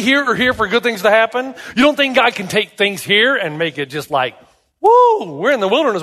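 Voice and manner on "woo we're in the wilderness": high, whooping cheer; hammed-up gleeful holler; mock celebration. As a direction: whoop it up, swooping down from the top. Imagined cheer about the wilderness.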